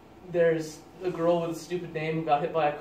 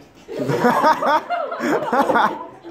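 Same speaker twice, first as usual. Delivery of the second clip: high, much louder laugh; 11 semitones higher, 10 dB louder than usual. Real, not acted. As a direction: burst out laughing loudly. Laughs at colorblind line.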